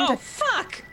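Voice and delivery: sudden pained yelp; stubbed toe. Real, not acted. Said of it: "I stubbed my toe".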